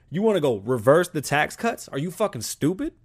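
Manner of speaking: usual speaking voice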